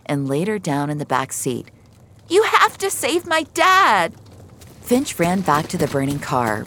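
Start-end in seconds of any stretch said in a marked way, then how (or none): 2.3-4.1 put-on high, loud pleading little girl; little girl begging for her dad; frantic child. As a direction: beg as a scared kid for her father. Child pleading after crash.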